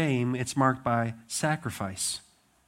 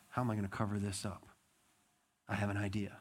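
scheming to himself; 5 semitones lower, 10 dB quieter, faster than usual. Retold or imitated. David plots the cover-up.

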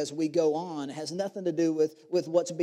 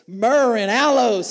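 higher and louder, carried away by the verse; worked-up reading; 6 semitones higher, 11 dB louder than usual. Acted. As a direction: build it to a peak.

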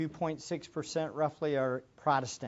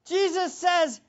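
loud, high, slow stressed scripture quote; emphatic preaching; 13 semitones higher, 13 dB louder, much slower than usual. Real, not acted. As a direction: draw out each word of the promise. Preaching at full force.

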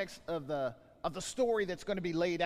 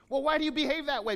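mock questioner; 6 semitones higher, 6 dB louder, faster than usual. Mock dialogue question and answer.

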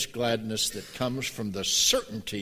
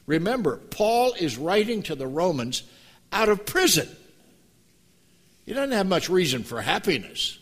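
rising, louder preacher's build; climbing sermon fervor; preaching at full force; 7 semitones higher, 4 dB louder than usual. Acted. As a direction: preach it, building to the word joy.

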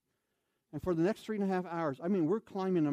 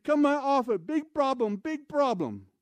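much higher, much louder foreign shout; yelling in broken English; 9 semitones higher, 6 dB louder than usual. Retold or imitated. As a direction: yell it like a frantic camp manager. Camp manager voiced again.

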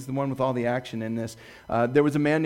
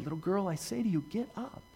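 much softer, tender quoted command; gentle command; 3 semitones higher, 10 dB quieter than usual. Retold or imitated. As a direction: coax a child softly awake. Jesus raising a child, quoted.

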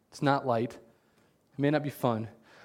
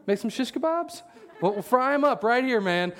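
much higher, fast, gleeful mock zeal; hammed-up eager revenge; 10 semitones higher than usual. Pastor asking God for fire, hammed-up glee. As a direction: ham up the eager smiting. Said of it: "That's what I'd be saying".

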